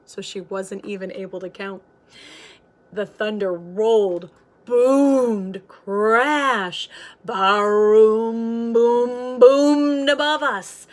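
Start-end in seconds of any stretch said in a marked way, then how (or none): 2.9-10.9 louder and louder booming storm; thunder building. A narrator's reading. Narrator building the storm up, louder and louder thunder. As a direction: let it grow into a big boom.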